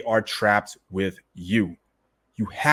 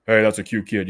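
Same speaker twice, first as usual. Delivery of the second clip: put-on lower, heavier voice, the wrong way to greet a baby; deep adult voice. Shown as a demonstration. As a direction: talk to the baby like a grown man. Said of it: "you don't go".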